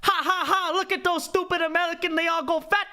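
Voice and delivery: high mocking laugh in a put-on Chinese accent; Xi Jinping. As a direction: jeer in a high, caricatured accent with a fake ha-ha laugh. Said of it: "No, he laughs. He's like".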